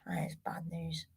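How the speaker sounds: real whispered dismay. Real, not acted. Fan telling viewers the table looks bad, whispered. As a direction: breathe it out in a whisper.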